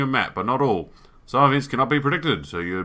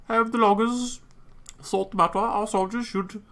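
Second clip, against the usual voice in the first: high-pitched attempt at a German accent; 8 semitones higher than usual. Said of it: "I went very German there".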